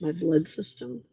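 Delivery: quiet, questioning rise on "blood system"; puzzled echo. Real, not acted. Catching her own odd word, she echoes it in puzzled surprise. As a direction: repeat "My blood system?" as if hearing it for the first time.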